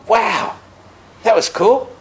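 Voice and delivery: high, amazed little wow. Retold or imitated. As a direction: marvel at what you just saw. Amazed at the butchers' smiles.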